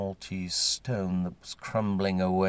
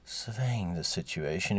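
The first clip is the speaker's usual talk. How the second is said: ordinary delivery, nothing marked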